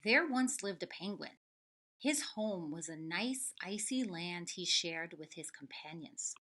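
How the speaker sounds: lively storybook reading; reading to children. Acted. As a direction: read it out to a class of kids. Reads picture book aloud.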